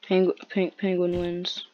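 ordinary delivery

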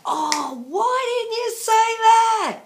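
high, loud, drawn-out wail of regret; mock-wailing frustration at herself; exasperated self-scolding. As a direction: berate yourself, stretching the last word way up. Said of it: "walked away and thought".

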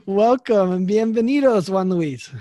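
English speaker's Spanish; Anglicized Spanish greeting; American accent Spanish. Real, not acted. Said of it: "I'm sorry for my terrible Spanish".

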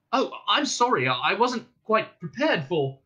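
high, stammering embarrassed apology; flustered apology. A narrator's reading. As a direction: backpedal awkwardly, stumbling. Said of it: "with sudden realization".

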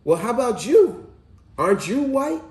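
high, loud mother voice; mother's question. Retold or imitated. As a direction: imitate a mom challenging her son. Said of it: "she said".

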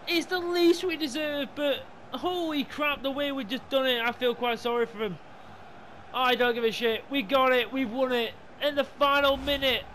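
high-pitched, excited disbelief; breathless elation. Real, not acted. Gamer reliving the final-minute winner, high-pitched elation. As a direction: gush over the win, still worked up.

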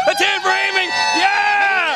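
high shouted call for applause; whooping showman's shout; cheering. Pretend. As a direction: lead the crowd in a cheer. Leading cheers for crew member.

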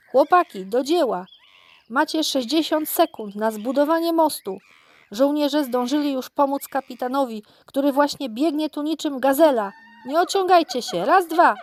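rally the troops to get to work fast; big monkey bossing the crew, urgent and forceful; giving orders. A narrator's reading. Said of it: "rozkazała kilku małpim samcom".

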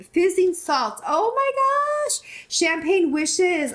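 high, slow, thrilled exclaiming. Real, not acted. YouTuber marvelling at the gift's label, thrilled.

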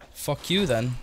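usual speaking voice

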